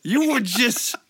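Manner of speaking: shouted high Southern wrestler promo; wrestling promo. Acted. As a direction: roar it like a Southern pro wrestler. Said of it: "I love how [name] turned Southern".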